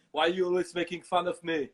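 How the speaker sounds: loud, thick Austrian action star; offended muscleman impression; Arnold Schwarzenegger. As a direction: complain in a heavy Austrian accent. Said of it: "then Schwarzenegger pops up".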